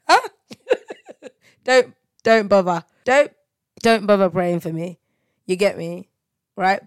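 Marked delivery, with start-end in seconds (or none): 0.5-1.3 high laugh. Real, not acted. Laugh after teasing.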